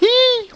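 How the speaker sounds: a piercing very high squeal; shrieking eee. Acted. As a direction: shriek with sudden delight. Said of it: "and then shrieked".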